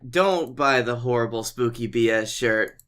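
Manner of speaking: normal talking voice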